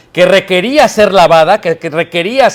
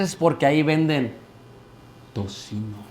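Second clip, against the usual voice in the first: softer and lower, as if someone might overhear; whispered secret; 6 semitones lower, 12 dB quieter than usual. Retold or imitated. Guide in Israel explaining the bacon shop, near whisper. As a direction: drop to a near whisper on the forbidden word.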